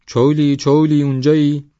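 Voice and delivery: high, loud shout from afar; loud calling out. A narrator's reading. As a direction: call out to someone inside the hut. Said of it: "با صدای بلند گفت".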